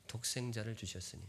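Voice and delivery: 독생자를 주셨으니 echoed quietly; softly repeated. Shown as a demonstration. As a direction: let 독생자를 fall softly, reverent. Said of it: "성경 표현 줄 치세요".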